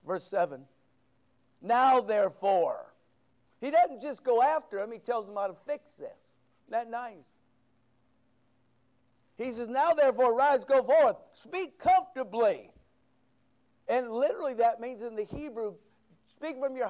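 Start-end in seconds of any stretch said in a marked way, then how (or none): 1.6-2.9 loud, slow "Now therefore" with the weight on "Now"; booming proclamation. Acted. Preacher booming a scripture quote. 9.7-12.7 loud, slow "Now therefore" with the weight on "Now"; booming proclamation. Acted. Preacher booming a scripture quote.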